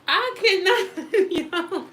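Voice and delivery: high, helpless laughter through words; laughing. Real, not acted. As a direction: crack up mid-sentence. Cracking up at a friend.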